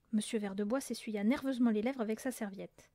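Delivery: usual speaking voice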